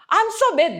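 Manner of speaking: high-pitched, laughing outburst; mock anger. Imitating candid Western listeners, in mock anger. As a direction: imitate comic fuming, pitch way up. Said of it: "막 이래요, 정말로".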